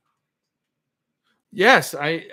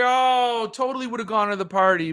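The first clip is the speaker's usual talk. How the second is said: mock excuse; 6 semitones higher, 3 dB louder than usual. Mimicking a flaky friend's excuses.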